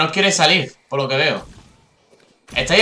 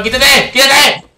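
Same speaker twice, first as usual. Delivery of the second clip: louder, higher urgent yelp; urgent shout; 8 semitones higher, 4 dB louder, slower than usual. Real, not acted. Player yelling at an enemy to move, urgent shout. Urgent cry mid-game.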